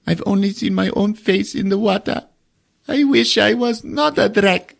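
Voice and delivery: put-on high, wistful child, slowing at the end; a boy wishing for a human face; sad kid voice. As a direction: play the sad little alien boy. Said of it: "He's like".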